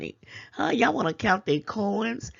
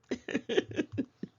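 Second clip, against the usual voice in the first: breathy laugh; 6 semitones higher, 6 dB quieter than usual. Real, not acted. Laughs after calling them out.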